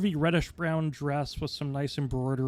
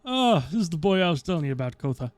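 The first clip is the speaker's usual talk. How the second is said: higher, louder and fast dwarf voice; excited dwarf; 5 semitones higher, 7 dB louder, faster than usual. Acted. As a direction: rattle off the introduction. GM voices Thrumal.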